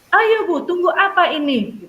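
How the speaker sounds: tirukan anak marah, jauh lebih tinggi dan keras. Retold or imitated. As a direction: protes yang tidak sabar. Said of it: "Tiba-tiba anak itu marah". (anak memprotes guru dengan marah dan suara tinggi)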